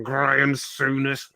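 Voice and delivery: very slow, drawn-out annoyed growl; mock growl. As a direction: drag the name out like it hurts. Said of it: "the fist and the growl".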